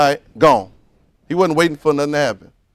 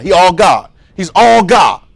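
"all" shouted, louder and higher, in "He's all God"; shouted emphasis; 7 semitones higher, 14 dB louder, slower than usual. Acted. Preacher shouting "He's all God" to the room. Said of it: "preacher repeating for emphasis".

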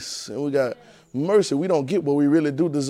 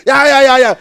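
high, loud yeah, yeah; fired-up affirmation; excited yeah; 11 semitones higher, 13 dB louder than usual. Real, not acted. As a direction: shout the yeahs up to the crowd. Calling out in sermon.

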